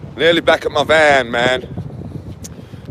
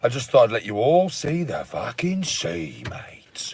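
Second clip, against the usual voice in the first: mock whisper; 4 semitones lower, 8 dB quieter than usual. Mock hushed aside.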